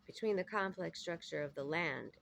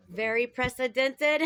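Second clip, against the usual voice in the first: loud exclaim; 6 semitones higher, 11 dB louder than usual. Real, not acted. Mock stumble on hard word.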